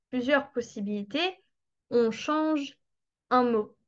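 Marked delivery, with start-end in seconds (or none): none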